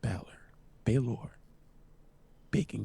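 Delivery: hushed, drawn-out words close to the mic; low, slow near-whisper with long pauses; slow and deliberate. Shown as a demonstration. Podcaster setting the chat straight, slow and deliberate. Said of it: "Let me say it slowly".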